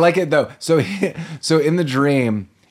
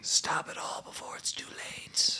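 slow unvoiced menacing whisper; breathy whisper; 14 dB quieter than usual. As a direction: hiss the warning through the phone. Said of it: "breathy voice".

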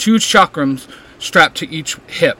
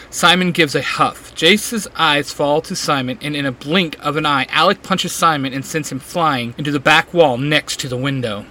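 usual speaking voice

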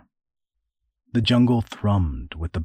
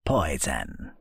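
put-on lower, cracked old man's voice; cracking old voice; 6 semitones lower, 5 dB quieter than usual. Old man answers the boy, cracking voice. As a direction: croak it like a wizened old man. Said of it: "came a cracking voice in reply".

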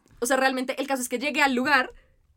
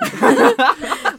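loud laugh; 10 semitones higher, 11 dB louder than usual. Real, not acted.

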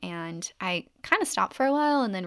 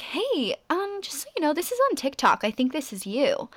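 imitating careful texters; 7 semitones higher than usual. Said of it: "And they were like".

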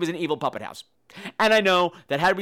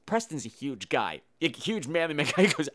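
ordinary talk, nothing marked

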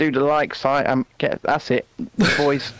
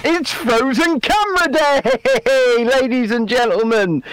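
milk it like a showman opening the show; mock show announcement; 6 semitones higher, slower than usual.

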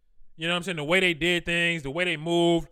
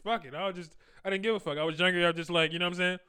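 ordinary talk

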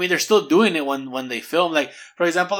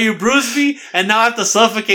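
high, louder mock fish complaint; the fish accusing; 6 semitones higher, 5 dB louder than usual. Voicing the fish blaming Bear, high and louder. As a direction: whine it as the hurt fish. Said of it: "He's like".

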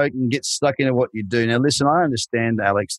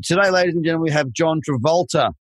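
higher, showman reveal; hammed-up game show announcer; mock grand announcement; 6 semitones higher than usual. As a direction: hype up the fake star guest.

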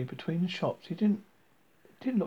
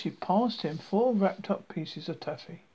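usual speaking voice

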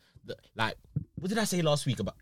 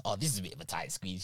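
soft aside; 5 semitones lower, 11 dB quieter than usual. Retold or imitated. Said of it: "I was saying this".

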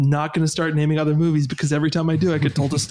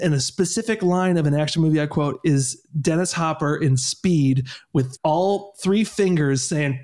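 ordinary talk, nothing marked

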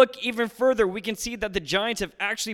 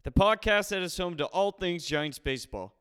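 podcast intro read; 4 dB quieter than usual. Acted.

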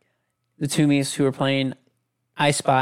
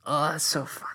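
soft breathy laugh-whisper; hushed amused remark; whispered aside; 8 dB quieter than usual. Real, not acted.

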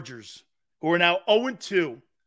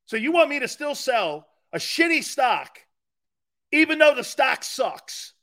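loud, indignant rhetorical question; ranting shout; 5 semitones higher, 4 dB louder than usual. Real, not acted. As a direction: yell it at the critic, fed up. Ranting at a critic.

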